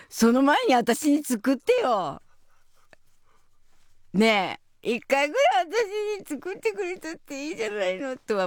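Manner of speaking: a mother jokingly sulking that her son never cooks for her; mock complaint. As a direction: whine it jokingly like a neglected mother. Mock grumbling about son.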